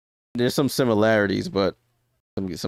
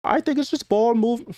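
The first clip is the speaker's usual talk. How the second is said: much higher, put-on Australian accent; attempted Australian accent; 11 semitones higher than usual. Streamer mimicking an Australian player, shaky-attempt Australian accent. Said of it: "mimic the Australian accent".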